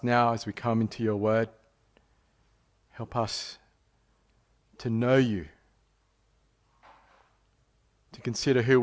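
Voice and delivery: soft, low-pitched prayer voice; hushed prayer, falling away to the amen. Real, not acted. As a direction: pray quietly and reverently, pausing between petitions. Opening prayer before sermon.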